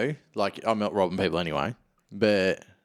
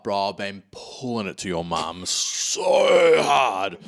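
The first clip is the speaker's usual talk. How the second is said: slow, loud jeering shout; mock schoolkid taunt; 4 dB louder, much slower than usual. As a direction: drag it out as a teasing yell.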